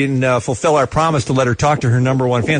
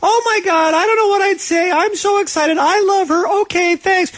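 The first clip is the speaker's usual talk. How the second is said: very high, mock starstruck fan; squeal-pitched imitation of a thrilled fan; Nick. Host mimics the fan offered a call with his idol, mock excited. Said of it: "And he's like".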